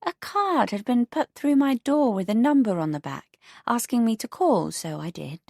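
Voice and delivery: put-on a prim elderly neighbour; prim older neighbour. Beatrice retells the call.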